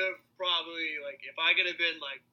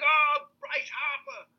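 cheering fan; 11 semitones higher, much slower than usual. Retold or imitated. Fans cheering his name.

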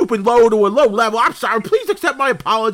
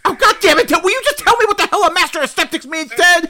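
exasperated yell; 8 semitones higher than usual. Acted. Host yelling at a dimwitted caller in exasperation. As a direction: blow up at a clueless caller.

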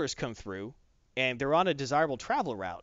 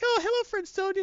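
much higher, sing-song friendly troll; mock sweet troll voice, much higher; hippie troll. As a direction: greet her like a blissed-out hippie troll. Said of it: "And he's like".

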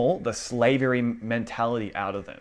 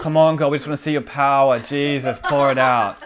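louder, urgent imitation of begging God; mock persuading prayer; 3 semitones higher, 7 dB louder than usual. Worshipper urging God to show power, mock desperate. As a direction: push the plea hard, overdone. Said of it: "as in we're trying to persuade him to move".

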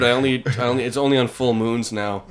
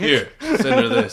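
hammed-up time traveller; 12 semitones higher, 4 dB louder, slower than usual. Voicing time-traveller Randy.

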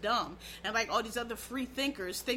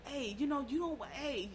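devil whispering; 2 semitones higher, 8 dB quieter than usual. Pretend. The devil tempting someone to steal and kill, in a soft hushed voice. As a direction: coax them like the devil in their ear. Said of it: "The devil whispers".

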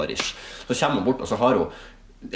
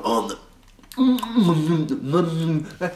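slow, higher, chewed words; mock mouth full; 7 semitones higher, much slower than usual. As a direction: mumble through a mouthful. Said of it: "tygde ut halvparten". (tygget, hermet)

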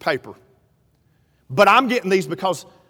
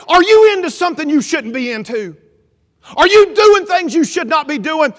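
higher, forceful retold questioning; accusing interrogation; 7 semitones higher, 7 dB louder than usual. Wife questions preacher about wrongdoing, acted accusing interrogation.

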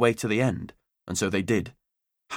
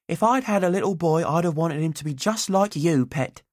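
put-on higher, doting old auntie voice; fond Auntie Ivy; 8 semitones higher than usual. A narrator's reading. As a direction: speak as a fond childless aunt.

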